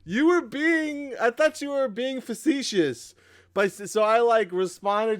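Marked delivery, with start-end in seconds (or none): 0.0-3.1 high, slow imitation of her excuse; mock defensive girlfriend. Host imitating girlfriend's excuse, high and slow. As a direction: play her pleading innocence, lightly voiced. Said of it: "She's like".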